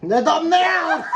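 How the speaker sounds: slow, high, loud exclamation; excited. Real, not acted. Mocking the thumbnail remark.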